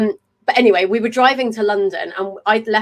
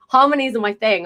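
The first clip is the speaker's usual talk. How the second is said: usual speaking voice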